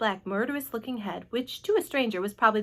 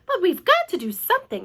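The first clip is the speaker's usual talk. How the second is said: raised, loud insistence; grim announcement; 8 semitones higher, 5 dB louder than usual. A narrator's reading. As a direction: announce it grimly and firmly. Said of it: "announced grimly".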